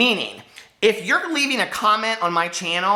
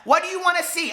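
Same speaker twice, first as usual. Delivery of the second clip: ordinary delivery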